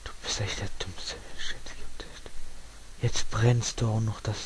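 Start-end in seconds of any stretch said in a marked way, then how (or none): 0.0-2.3 soft unvoiced whisper, much softer; breathy whispered insult. Real, not acted. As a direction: hiss the insult under your breath. Insulting his Sim under breath.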